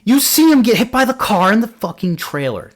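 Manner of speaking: high, heated rant; infuriated, high-pitched complaint; real exasperation. Real, not acted. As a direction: let the outrage push your pitch way up. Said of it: "That's infuriating".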